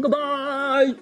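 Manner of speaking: ordinary talk, nothing marked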